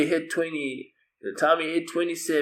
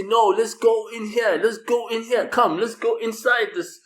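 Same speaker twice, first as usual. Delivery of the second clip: put-on child-minded coaxing voice; 10 semitones higher, 5 dB louder than usual. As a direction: lure him inside, pitched up. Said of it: "she's like, trying to say".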